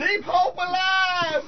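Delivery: slow, high shouted chant; rally shout. Acted. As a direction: cry it out like a preacher.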